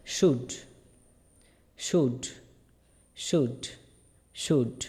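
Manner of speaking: শুড said aloud as "shood", with gaps to echo; repeat-after-me drill. Shown as a demonstration. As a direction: drill শুড as separate, clear repetitions. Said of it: "বলেন আমার সাথে আওয়াজ করে".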